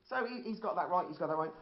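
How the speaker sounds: ordinary delivery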